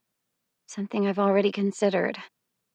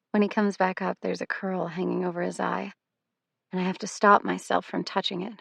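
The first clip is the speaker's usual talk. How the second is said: plain reading voice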